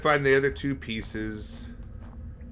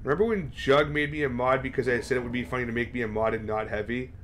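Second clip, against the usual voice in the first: ordinary talk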